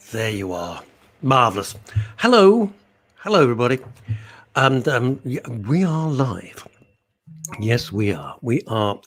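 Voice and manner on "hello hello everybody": high, loud on-air hello; cheery radio greeting; bright loud hello. Real, not acted. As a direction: call out a big hello to listeners. Live show opening greeting.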